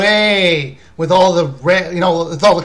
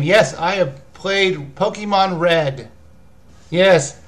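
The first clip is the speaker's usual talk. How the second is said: usual speaking voice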